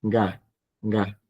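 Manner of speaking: Ga said softly with a voiced g; Ga, the soft middle g; soft g sound. Shown as a demonstration. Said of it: "se pronuncia como una G".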